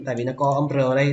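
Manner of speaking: usual speaking voice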